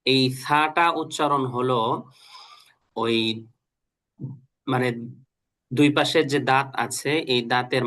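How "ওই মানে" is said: ordinary talk, nothing marked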